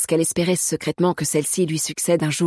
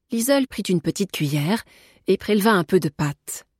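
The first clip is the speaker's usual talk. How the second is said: usual speaking voice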